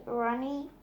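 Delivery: ordinary delivery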